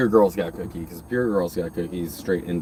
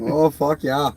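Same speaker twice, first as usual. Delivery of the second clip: mock Minnesota accent; 5 semitones higher, slower than usual. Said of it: "that's why I got the accent".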